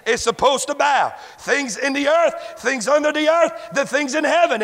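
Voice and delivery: ordinary talk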